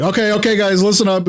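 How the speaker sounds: high-pitched bossy imitation of an irritated leader; mock angry. Guild leader taking over the call, mock angry. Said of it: "angrier and louder".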